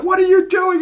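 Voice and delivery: very high, squeaky mock panic; mock alarm. Kid questioning his friend dragging the body, mock alarm. Panicked character voiced in retelling.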